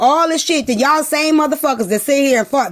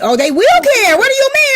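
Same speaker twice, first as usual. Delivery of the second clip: mock outrage; 12 semitones higher, 4 dB louder than usual. Mock indignant outburst.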